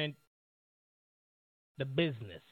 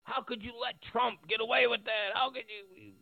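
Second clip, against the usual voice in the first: mock reproach; 6 semitones higher than usual. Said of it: "A whole lot of 'em".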